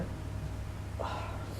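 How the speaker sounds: long, soft shaky exhale; heavy sigh. Real, not acted. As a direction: let out a grieving breath. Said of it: "sorry, I'm emotional here now".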